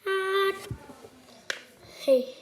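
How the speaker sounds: ordinary talk, nothing marked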